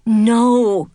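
a loud no of dismay; boy crushed by punishment, loud no; dismayed cry. A narrator's reading. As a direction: cry out at the bad news. Gunther hears he is sent off.

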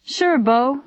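calling across room. A narrator's reading. Owner answering guitarist from across the room, mild call. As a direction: call back without truly yelling. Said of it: "Louis called from across the room".

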